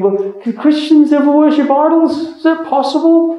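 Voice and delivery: high-pitched disbelief, voice lifted right up; incredulous question. Acted. Preacher voices a listener doubting Christians could worship idols, incredulous. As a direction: ask it as a baffled, doubting listener.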